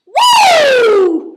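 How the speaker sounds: loud, high woo; celebratory whoop. Real, not acted. After a shot for Colton's exit, a loud whoop.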